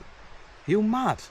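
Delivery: incredulous echo. Retold or imitated. Echoes his incredulous retort.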